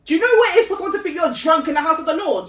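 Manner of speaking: loud, high and fast rhetorical question; fervent, rushed challenge. Real, not acted. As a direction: press the point hard and fast.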